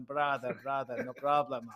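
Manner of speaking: put-on, easygoing Russian accent. Brazilians chilling in the sauna, voiced with a stray Russian accent. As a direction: reassure in a mock Russian accent. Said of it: "they're talking a Russian accent".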